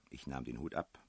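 ordinary delivery

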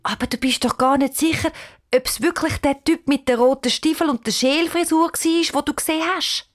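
acted uncertain child; unsure. A narrator's reading. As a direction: voice doubt to a friend, pitch climbing. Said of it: "Sie schaut unsicher zu der Cheyenne".

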